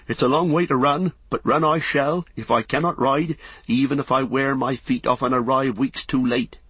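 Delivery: high, eager stubborn vow; determined hobbit. A narrator's reading. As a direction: insist you'll run all the way. Hobbit vows to follow.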